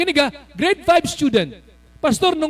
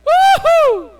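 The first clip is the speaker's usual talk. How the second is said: high, loud woohoo cheer; joyful whoop; 7 dB louder than usual. Real, not acted. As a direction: whoop like a happy crowd. Preacher cheers. (masayang hiyaw na woohoo)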